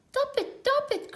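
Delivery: very high cry, words blocked by a knot; knotted trunk cry. A narrator's reading. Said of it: "cried the elephant".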